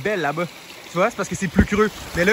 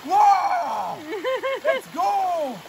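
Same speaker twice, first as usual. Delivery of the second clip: excited cheer; 12 semitones higher, much slower than usual. Real, not acted. Pumped in icy water.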